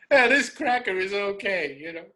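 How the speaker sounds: a lightly voiced local guy approving; imitating one of the guys. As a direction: play the friendly local warming up.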